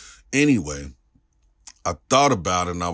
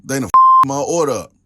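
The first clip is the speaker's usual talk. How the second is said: mock outburst; 8 semitones higher, 10 dB louder than usual. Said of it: "I'm like".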